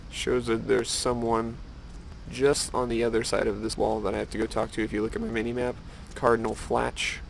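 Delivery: normal talking voice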